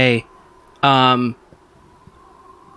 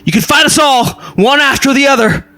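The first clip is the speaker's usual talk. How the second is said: challenging knight; 12 semitones higher, 3 dB louder than usual. Acted. Third knight dares the duelist to fight all, high shout. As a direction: throw down a loud, high-pitched challenge. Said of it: "another one of the knights says".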